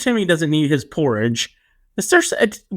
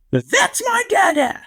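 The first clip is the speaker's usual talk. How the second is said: put-on high, slow little-boy voice, called out proudly; toddler voice imitation; 13 semitones higher, slower than usual. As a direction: imitate a toddler announcing his dad. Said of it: "and he'll just go".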